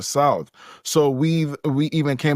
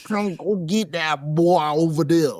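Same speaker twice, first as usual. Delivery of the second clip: a clumsy fake Southern accent, barking the order; bad Southern accent; 3 semitones higher than usual. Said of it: "Your accents are terrible".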